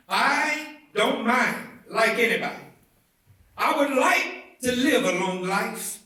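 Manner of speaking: rising preacher's fervor, building to the peak; rousing preaching. Acted. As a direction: lift the voice toward the mountaintop. Sermon climax rising to peak.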